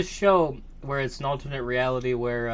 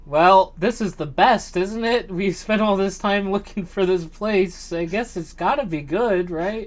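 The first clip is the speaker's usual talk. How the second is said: Columbus self-justifying; 5 semitones higher, 5 dB louder than usual. Retold or imitated. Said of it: "He's just like".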